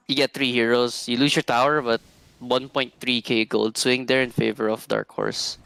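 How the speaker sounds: usual speaking voice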